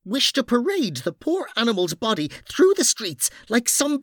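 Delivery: high, outraged scolding; indignant woman character. Acted. Noreen berating two scuffling men over a fallen hero, outraged. Noreen's tirade continues at Mara.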